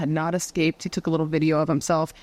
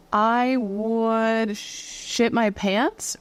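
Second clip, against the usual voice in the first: drawn-out emphasis; 3 semitones higher, much slower than usual. Pretend. Woman imagining a doll choking her, drawn-out comic dread. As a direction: draw out "I would" for comic horror.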